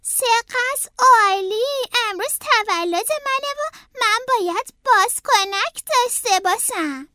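high, eager character voice; excited rabbit. A narrator's reading. Howard reaching the balloon seller, high excited voice. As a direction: voice a rabbit talking himself into a treat.

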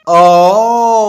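long, high "aaah" cry; stretched narrated yell; cried out. A narrator's reading. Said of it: "رتلسنا فریاد زدن".

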